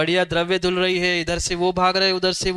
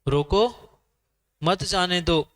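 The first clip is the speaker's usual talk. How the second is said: रोको on its own, long break, then मत जाने दो as one phrase; pause after रोको. Shown as a demonstration. Teacher quizzing listeners on what the board said, pause after रोको. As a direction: hold the comma after रोको. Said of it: "रोको के बाद जो कॉमा था".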